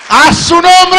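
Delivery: a sudden loud, high shout; shouted claim. Acted. Devil demanding Jesus from God, loud shout. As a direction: bellow it out to God.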